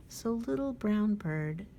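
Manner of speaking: ordinary delivery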